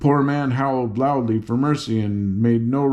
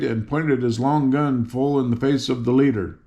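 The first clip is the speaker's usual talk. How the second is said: plain reading voice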